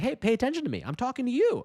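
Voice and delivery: mock demand to put the phone down; imitating calling out. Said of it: "it's kind of like a saying".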